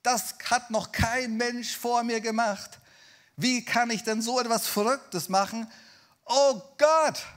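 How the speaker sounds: high, loud voice of alarm; panicked Peter. Acted. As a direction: act out rising panic to a loud cry. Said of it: "und dachte sich".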